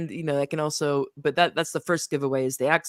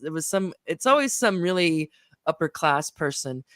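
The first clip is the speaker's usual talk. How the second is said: ordinary talk, nothing marked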